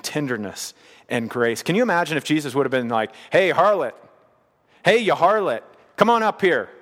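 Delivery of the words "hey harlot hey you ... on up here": preacher mimicking a harsh accuser to a sinful woman; mock harsh calling out. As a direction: bark it like a scornful accuser. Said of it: "He didn't talk to her like that".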